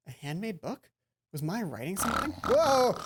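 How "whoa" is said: loud, high startled cry; real fright at a sudden scare; startled whoa. Real, not acted. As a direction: jump at the scare. Startled by game jump.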